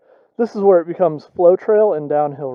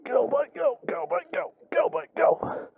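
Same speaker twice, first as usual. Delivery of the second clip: whispered urging; 11 semitones higher, 10 dB quieter than usual. Real, not acted. Urging the bike uphill.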